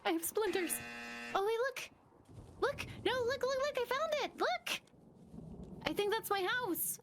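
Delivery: high excited calling; rising pitch, thrilled discovery. Real, not acted.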